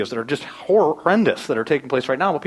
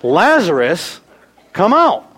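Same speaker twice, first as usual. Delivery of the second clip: commanding call; 6 dB louder, much slower than usual. Shown as a demonstration. Jesus calls dead Lazarus from the tomb, commanding call. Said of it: "He has to say "Lazarus".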